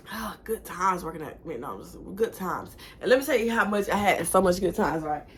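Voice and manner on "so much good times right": ordinary talk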